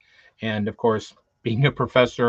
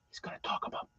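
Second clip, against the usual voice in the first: very soft, voiceless whisper; whispered aside; 15 dB quieter, faster than usual. Real, not acted. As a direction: whisper it like a secret. Mock-secret lead-in to big news.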